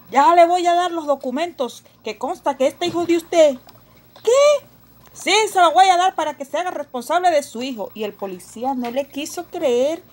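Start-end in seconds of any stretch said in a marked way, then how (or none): 0.1-3.6 loud stern accusation; a cop refusing to believe her; accusing policeman. Acted. Policía impone documentos. 4.2-4.6 very high, loud outraged cry; can't believe what he said; shocked. Acted. Sophie escandalizada. 5.1-7.9 loud stern accusation; a cop refusing to believe her; accusing policeman. Acted. Policía impone documentos.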